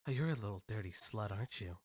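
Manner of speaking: whisper-soft parody of a man flirting with himself; mocking seductive mirror talk. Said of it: "I'm talking like he would".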